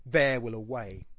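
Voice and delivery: "There will away" with a loud beat on "There"; stress on first and last. Shown as a demonstration. Teacher showing how stresses envelop the line, "There" and "-way" stressed. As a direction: hit "There", ease off, then land on "-way". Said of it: "it's like this boom".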